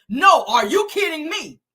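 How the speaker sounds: retold indignant retort, each word pushed; cutting her off. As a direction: throw the question back forcefully. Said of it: "cut her off and says".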